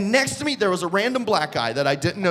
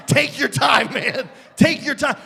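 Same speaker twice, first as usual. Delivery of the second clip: loud, higher incredulous repeat; incredulous quoting; 4 semitones higher, 4 dB louder than usual. Retold or imitated. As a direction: throw the quote back in disbelief, pitched up. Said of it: "this guy's pre-programmed response was".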